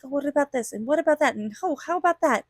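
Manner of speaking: acted-out curiosity; curious wondering. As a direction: wonder aloud, pitch lifted on each question. Voices curious looking at new things.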